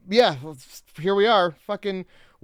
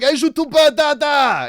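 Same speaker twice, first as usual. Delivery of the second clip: hammed-up French lover, higher and louder; mock French; 8 semitones higher, 6 dB louder, slower than usual. Mocking the French character with a loud cheesy French line. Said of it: "why didn't you just be like".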